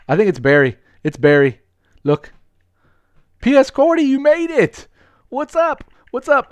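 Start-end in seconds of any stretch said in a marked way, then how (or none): 3.4-6.5 high, loud excited hello; high-pitched loud cheer of welcome; excited greeting. Real, not acted. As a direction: greet a friend who just arrived, thrilled. Greeting friend arriving in game.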